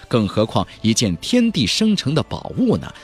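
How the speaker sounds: ordinary delivery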